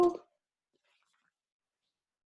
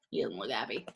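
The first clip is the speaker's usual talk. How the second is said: quiet aside; 13 semitones lower, 9 dB quieter, much faster than usual. Real, not acted. Aside to listeners while reading.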